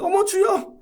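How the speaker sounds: high startled gasp of prayer; surprised high-pitched lady exclaiming. Retold or imitated. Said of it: "엘리베이터를 닫히는데".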